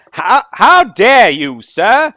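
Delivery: high, loud, slow pompous gentleman; mustache twirling villain. Pretend. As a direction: ham up the affronted aristocrat. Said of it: "no, better yet".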